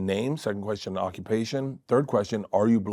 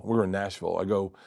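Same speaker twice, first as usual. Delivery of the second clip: ordinary delivery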